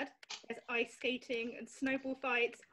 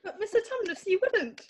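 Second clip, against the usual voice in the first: distressed plea; 5 semitones higher, 5 dB louder than usual. Acted. Lucy pleads, betrayed.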